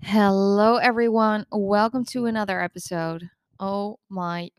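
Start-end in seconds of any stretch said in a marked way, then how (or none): none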